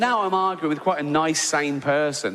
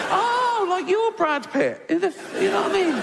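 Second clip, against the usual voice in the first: camp mock flattery. Teasing the devil about Brad Pitt, high swooning camp voice. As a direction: gush like a flattered diva.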